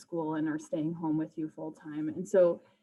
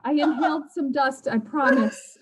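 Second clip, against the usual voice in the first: higher, louder anxious insisting; pleading excuse; 7 semitones higher, 7 dB louder than usual. Pretend. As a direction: insist nervously you're not sick. Acted excuse after sneezing in store.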